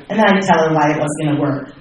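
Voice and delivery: usual speaking voice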